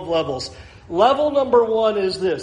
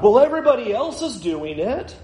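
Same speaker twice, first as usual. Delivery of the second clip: mocking excuse; 6 semitones higher than usual. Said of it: "We want to play the same game with God".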